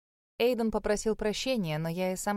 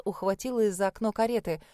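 plain reading voice

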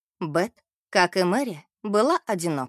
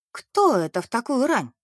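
puzzled, higher-reaching question; surprised; 4 semitones higher than usual. A narrator's reading. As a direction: wonder aloud in mild surprise. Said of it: "удивилась Мэри".

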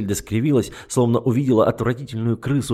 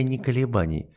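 ordinary delivery

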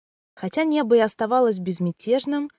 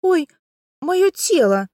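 much higher, louder alarmed cry; startled cry; 9 semitones higher, 6 dB louder, slower than usual. Acted. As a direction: gasp out in shock at your body. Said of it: "От неожиданности".

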